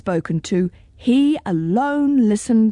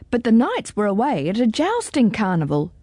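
ordinary delivery, nothing marked

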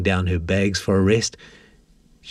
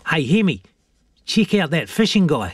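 put-on high boyish voice; young boy Jack; 8 semitones higher than usual. A narrator's reading. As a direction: call your mate over, high-pitched. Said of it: "said Jack".

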